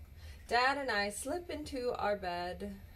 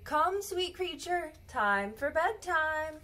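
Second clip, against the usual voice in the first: sing-song call; 7 semitones higher than usual. Acted. Mother calling child to bed.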